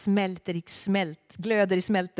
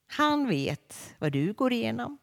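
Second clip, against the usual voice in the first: ordinary delivery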